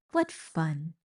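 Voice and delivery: ordinary delivery, nothing marked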